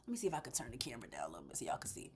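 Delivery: quick whisper to herself; fast whispered camera aside. Real, not acted. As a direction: mutter it under breath while fiddling with the camera. Aside while adjusting camera.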